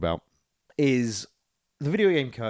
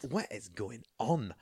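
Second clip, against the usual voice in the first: slow, softer, badly faked Scottish accent; Scottish accent attempt; 8 dB quieter, slower than usual. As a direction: have a poor go at a Scottish accent. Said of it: "Your s-, your accent is".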